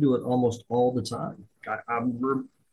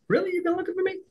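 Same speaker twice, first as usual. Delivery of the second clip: much higher, incredulous squeak; mock surprise; much faster than usual. NCO surprised the chief sought him out, squeaky high. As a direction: act amazed he came looking.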